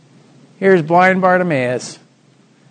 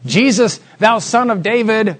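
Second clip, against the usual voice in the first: higher-pitched cry for Jesus' attention; 6 semitones higher, 3 dB louder than usual. Retold or imitated. Blind men call to passing Jesus, crying out. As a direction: call out like a beggar by the road. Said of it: "Who cries out for mercy?".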